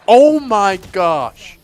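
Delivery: slow, loud, high disbelief; shocked. Real, not acted. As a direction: stretch it out in shock. Reacting to a wild play.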